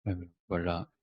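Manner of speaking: fading to a near whisper; trailing off. Real, not acted. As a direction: let the words die away, overcome. Loses thread, overcome by emotion.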